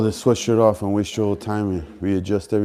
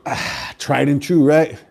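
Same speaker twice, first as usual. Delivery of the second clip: slow, emphatic motivational voice; 6 semitones higher, slower than usual. Acted. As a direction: land it like a gym slogan. Motivational closing line to viewers.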